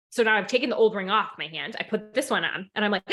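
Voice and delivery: usual speaking voice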